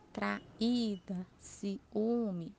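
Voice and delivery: traída and ciúme with the í and ú held as their own syllables; stressed separate vowels. Shown as a demonstration. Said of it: "Quando separar as sílabas". (hiato destacado no "í" de "traída" e no "ú" de "ciúme")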